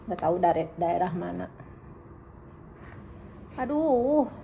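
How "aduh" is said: usual speaking voice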